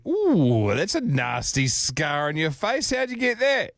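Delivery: loud, drawn-out gasp rising high; tactless shock. Retold or imitated. Speaker blurting to a lady about her face, tactless shock. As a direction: exclaim with concern, pitch leaping on the question.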